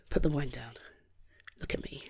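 soft whispered coaxing. Acted. Husband coaxing his stressed wife to look at him, whispering. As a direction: whisper it gently to make her look up.

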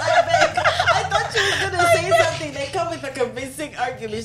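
high, loud laughing plea. Real, not acted. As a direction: beg while cracking up. Begging for a sex toy.

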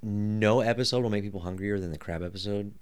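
ordinary talk

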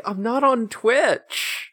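high, drawn-out mock protest; Kyoshiro. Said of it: "And he's like".

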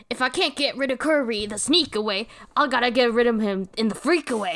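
hammed-up Dedede scheming; a hint of a blustering king. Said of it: "He says a very choice line".

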